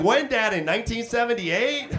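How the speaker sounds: mock disbelief at his dad; incredulous. Son mocking dad's hooker price.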